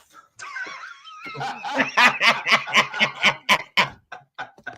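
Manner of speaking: shrieking burst of laughter; loud high laugh. Real, not acted. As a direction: howl with laughter. Cracks up at the clip.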